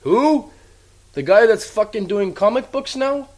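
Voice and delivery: high, loud disbelieving yelps; can't believe anyone would say that name; incredulous outburst. Real, not acted. Fan hearing the name Phil Brooks, high loud disbelief. Incredulous rant at returning wrestler.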